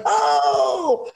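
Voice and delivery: high mock-shocked cry of oh; shocked kids. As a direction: gasp out like a scandalised child. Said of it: "And they're like".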